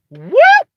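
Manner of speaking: high, loud whooshing "whoop" of being yanked away. Retold or imitated. Telling friends how he got yanked back, a loud high whoop. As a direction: fling out a high, loud "Whoop!" like getting snatched. Sent back into his body.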